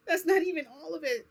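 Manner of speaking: high, loud laugh breaking through the words; giggling through the line. Real, not acted. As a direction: laugh it out in disbelief. Laughs at own story.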